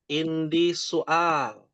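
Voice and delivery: slow, careful teacher demo; slow model phrase. Shown as a demonstration. Arabic teacher modelling a phrase slowly. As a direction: spell it out slowly for students. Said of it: "bahasa Arabnya adalah indi soal".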